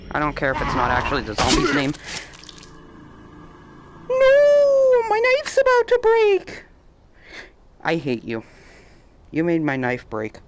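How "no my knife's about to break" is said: high panicked squeal. Real, not acted. Knife breaking, high squeal.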